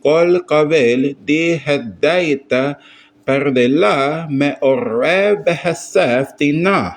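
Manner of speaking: measured recitation of an unfamiliar Aramaic text; sight-reading aloud. Shown as a demonstration. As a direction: read it out syllable by syllable, leaning on each word's accent. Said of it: "I'm just gonna sight read".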